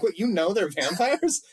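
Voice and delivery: high, drawn-out incredulous question; mock disbelief. Reader questioning a character about vampires, mock-incredulous. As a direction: balk at the character with exaggerated disbelief.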